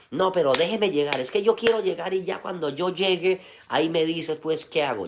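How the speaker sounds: a hammed-up stubborn traveller pushing past the guide. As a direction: play a pig-headed man refusing directions. Stubborn lost traveller answers guide.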